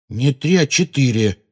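irritated, deliberate emphasis; cross. A narrator's reading. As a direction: correct them pointedly, annoyed. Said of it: "сердито поправил её Джинни".

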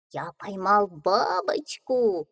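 slow little duckling voice. Acted. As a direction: draw it out like a duckling. Duckling speaks.

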